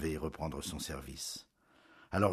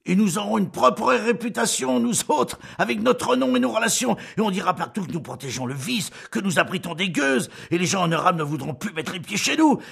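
loud, raised angry husband; Julien bursts out; 13 semitones higher, 10 dB louder than usual. A narrator's reading. Husband raging at wife over the maid, loud anger. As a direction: explode at her in outrage. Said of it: "Alors Julien éclata".

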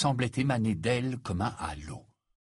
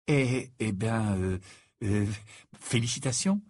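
awkward, broken-up delivery; hesitant stammer. Acted. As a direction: fumble towards polite congratulations. Perplexed Harry stammers congratulations.

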